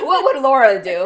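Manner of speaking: loud, laughing question. Real, not acted. Co-host teases her about hesitating over a friend, laughing. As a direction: say it through real laughter.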